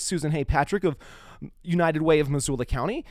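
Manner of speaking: ordinary talk, nothing marked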